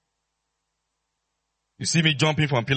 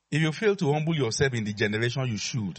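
normal talking voice

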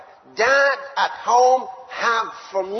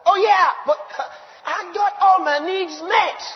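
high and loud, slowing on the claim; triumphant, savour every word; exultant proclaiming; 10 semitones higher, 5 dB louder than usual. Real, not acted. Preacher exults over met needs.

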